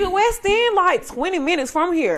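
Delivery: high, loud disbelief; calling out his lie; incredulous scolding. Retold or imitated. Mock scolding the visitor.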